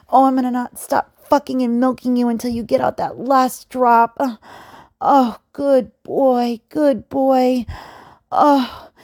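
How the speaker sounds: loud, breathless cheering on; excited, loud urging. Acted. Domme urging her partner to finish, loud.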